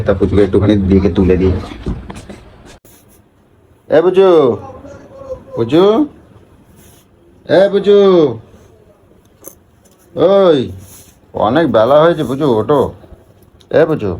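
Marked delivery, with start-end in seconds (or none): 4.0-14.1 calling out. Real, not acted. Calls wife to wake up.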